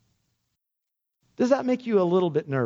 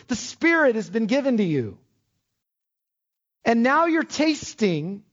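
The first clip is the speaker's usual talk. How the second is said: higher, insistent preaching voice; fervent preaching; 7 semitones higher than usual. Acted. Pastor urging the congregation, fervent preaching. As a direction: drive it home to the church.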